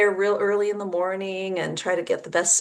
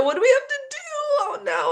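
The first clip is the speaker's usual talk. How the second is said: much higher, hammed-up panic; mock panic; faster than usual. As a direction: squeal it like it's a disaster. Panicking over the car repair.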